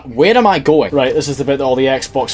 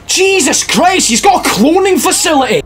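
real frustration at enemies; incredulous loud complaint; exasperated outburst; 5 dB louder than usual. Real, not acted. Overwhelmed by endless enemies.

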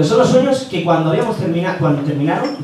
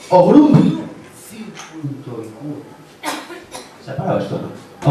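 Pedro Piqueras. Acted. Passenger gives the taxi driver an address, Piqueras impression dying away. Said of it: "Me para el taxi Pedro Piqueras".

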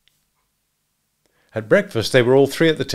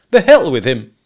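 very loud, raised-pitch curse; angry dismissal; 4 semitones higher, 11 dB louder than usual. A narrator's reading. Husband dismissing hunter's warning, furiously. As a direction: burst out, damning him.

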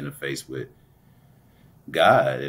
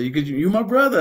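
much higher, louder call; excited reply; 13 semitones higher, 9 dB louder, faster than usual. Retold or imitated. Running to the train, calling back loud and high. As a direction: shout it back over his shoulder. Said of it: "I said".

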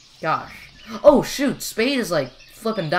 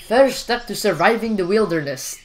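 put-on higher, louder Gobber-style accent; 4 semitones higher, 6 dB louder than usual. Gamer mimics Gobber teaching wilderness survival, playful Gobber accent. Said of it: "That's my Gobber accent".

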